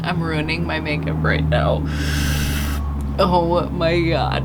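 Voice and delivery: laughing so hard it turns to tears; loud, slowed, laughed-through "oh my god"; real tears of laughter; crying with laughter. Real, not acted. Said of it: "I'm crying".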